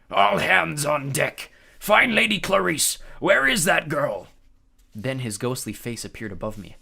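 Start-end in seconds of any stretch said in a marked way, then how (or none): 0.1-4.3 loud, slow ghost captain; gravelly ghost captain. A narrator's reading. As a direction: bark orders like a ghost captain. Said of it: "The captain's gravelly voice".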